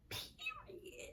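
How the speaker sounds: breathy whispered punchline; whispering. Acted. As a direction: whisper it as a final word. Whispered tag after sung line.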